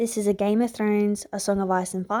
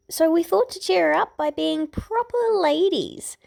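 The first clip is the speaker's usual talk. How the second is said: put-on girl's voice; 9 semitones higher than usual. A narrator's reading. Girl's voice in story.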